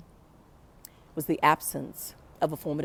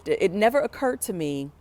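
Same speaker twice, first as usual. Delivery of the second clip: ordinary delivery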